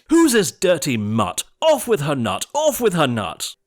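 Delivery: loud angry order; crying out. A narrator's reading. Prince ordering Cindy beheaded, loud. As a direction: bark out the order. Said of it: "The prince cried".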